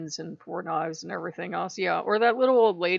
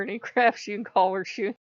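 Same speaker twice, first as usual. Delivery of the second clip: ordinary talk, nothing marked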